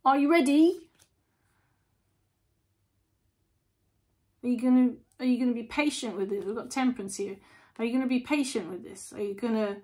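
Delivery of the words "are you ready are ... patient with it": higher, louder testing challenge; the universe calling you out, raised. Pretend. The universe challenging the listener, higher and louder. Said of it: "the universe coming in and going".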